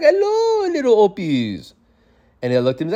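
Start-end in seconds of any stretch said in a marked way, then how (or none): 0.0-1.7 very high, slowing greeting; genie-like grandpa. Retold or imitated. Grandpa greets the little kids like a genie, high and drawn out. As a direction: imitate a fairy-like grandpa cooing hello, way up high. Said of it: "like, a fairy or, like, like the genie".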